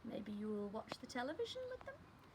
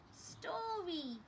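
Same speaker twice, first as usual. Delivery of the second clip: lightly voiced little baby, a bit higher; 9 semitones higher than usual. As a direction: ask it like a tiny toddler. The baby asks for a story.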